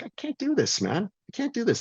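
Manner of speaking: high and strained, at the end of his rope; despairing cry. Retold or imitated. Sick on chemo, at the breaking point.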